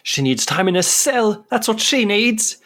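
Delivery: the angry lover refusing to back down; spiteful woman. Acted. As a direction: spit it out at the accused.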